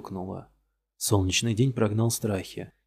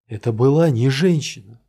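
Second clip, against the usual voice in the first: louder, higher outburst; insisting; 3 semitones higher, 6 dB louder than usual. Acted. Suspect insisting to the detective, loud outburst. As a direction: burst out against the claim.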